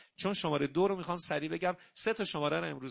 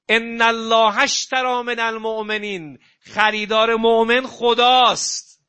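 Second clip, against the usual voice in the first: fiery preaching; 4 semitones higher, 10 dB louder than usual. Acted. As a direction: preach it to the whole hall at full voice. Preaching at full force.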